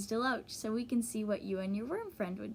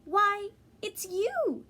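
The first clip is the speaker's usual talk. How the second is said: much higher, slow, drawn-out surprise; surprised bird; 11 semitones higher, 6 dB louder, much slower than usual. Acted. Early Bird realises the funny fellow is the worm, slow surprise. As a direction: let the recognition dawn slowly and brightly.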